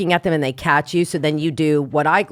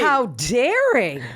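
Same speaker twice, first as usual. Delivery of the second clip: very drawn-out mock amazement; mock scandalized; 4 semitones higher, much slower than usual. Co-host hearing the steamy diary, hammed-up scandalized drawl. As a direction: stretch it out as if deliciously shocked.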